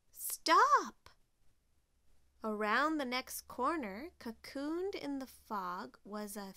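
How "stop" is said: high, loud cry of alarm; startling, raised-voice command; sudden shout. A narrator's reading. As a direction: shout to halt everyone abruptly. Sudden halt in the story.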